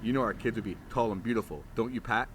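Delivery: smooth-talk her; flirty. Acted. Bruno flirting with Chloe at the door, low and suave.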